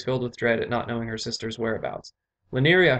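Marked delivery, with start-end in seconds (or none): 2.5-3.0 higher, louder call across open grounds; shouted call. A narrator's reading. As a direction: call out urgently for a daughter. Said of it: "her father shouted".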